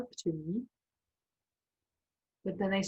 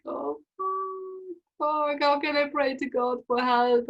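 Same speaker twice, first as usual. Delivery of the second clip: thin high moan of dismay, gaining volume; retold freak-out; 7 semitones higher, slower than usual. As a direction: wail it in retold panic, pitched up. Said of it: "I was freaking out".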